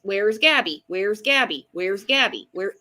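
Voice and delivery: ordinary talk, nothing marked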